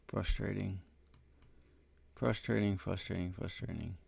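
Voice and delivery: frustrating. Real, not acted. Alone, venting after a losing trade.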